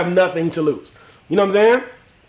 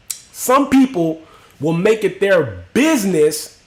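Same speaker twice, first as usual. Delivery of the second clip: slow emphatic point; much slower than usual. Real, not acted.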